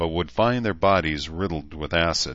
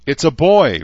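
louder, higher happy cry; joyful announcement; 9 semitones higher, 10 dB louder than usual. A narrator's reading. Rachel announcing the baby to the parents, loud and glad. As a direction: announce the birth with delight.

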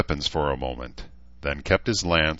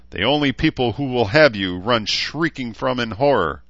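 high, loud jeering insult; mocking taunt; 7 semitones higher, 4 dB louder than usual. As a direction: needle him, savour each insult. Knight's insulting taunt at orc.

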